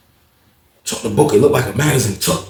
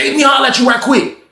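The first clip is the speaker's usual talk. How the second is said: calling out; 12 semitones higher than usual. Retold or imitated. Jerome calls him over.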